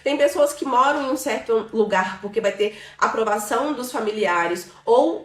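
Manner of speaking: ordinary talk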